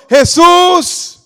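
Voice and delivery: loud, high cry for Jesus; imitated shouting. Lepers shouting to Jesus from a distance, imitated shout. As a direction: call out like someone begging from afar. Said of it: "oye a la gente gritando".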